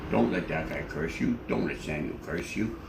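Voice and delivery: very low questioning voice; deep-voiced friend. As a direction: ask it low and calm. Anchor advises Gail.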